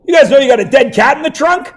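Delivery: a cop who just found a dead cat; hammed-up startled cop. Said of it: "comes back to the front and says".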